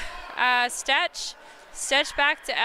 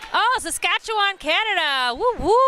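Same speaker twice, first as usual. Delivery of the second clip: high, whooping shout-out; delighted cheer; 6 semitones higher, 4 dB louder than usual. Real, not acted. Commentator greeting a viewer from Saskatchewan, excited cheer. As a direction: cheer the place name, ending in a whoop.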